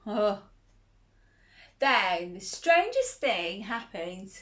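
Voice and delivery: plain reading voice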